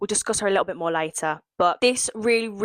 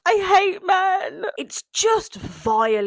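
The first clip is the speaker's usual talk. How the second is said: disgusted; 9 semitones higher, slower than usual. Real, not acted. Disgusted outburst.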